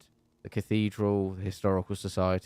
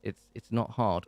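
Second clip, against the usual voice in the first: ordinary delivery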